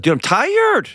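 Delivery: very high, stretched-out imitation of a tired wife; mock exhausted protest. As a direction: pitch it way up in mock complaint. Host voices the exhausted wife's protest.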